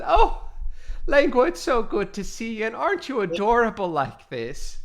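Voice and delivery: high, loud gushing over a friend; big excited hello to the cat; gushing greeting. Acted. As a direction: squeal with delight at the cat.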